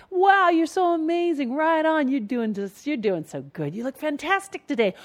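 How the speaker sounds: high-pitched gushing praise; mock fan enthusiasm, swooping pitch; cheering on. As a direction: gush like an admiring supporter. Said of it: "people who are cheering you on".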